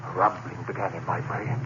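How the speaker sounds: ordinary delivery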